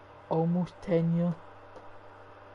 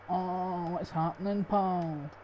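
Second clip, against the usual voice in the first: light Scottish greeting, pitched up a touch; friendly Glasgow hello in a Scottish lilt; casual Scottish pal greeting; Scottish accent; 3 semitones higher, slower than usual. Retold or imitated. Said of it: "Like the way Scottish folk would say it".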